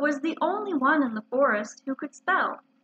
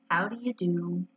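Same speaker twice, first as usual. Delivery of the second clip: gloomy; 5 semitones lower than usual. A narrator's reading. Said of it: "in a gloomy manner".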